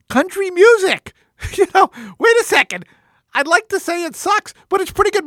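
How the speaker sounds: like he just found something great; excited discovery. Retold or imitated. Host recalling his car moment, excitedly surprised. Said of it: "And I say".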